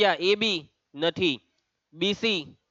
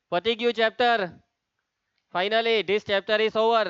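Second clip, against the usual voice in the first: triumphant announcement; 4 semitones higher, slower than usual. Real, not acted. Announces the chapter is finished.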